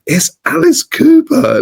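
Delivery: high, slow, awed gasp of a name; starstruck recognition. Retold or imitated. To himself, spotting [name] across the shop, awed. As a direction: breathe it out, stunned to see him.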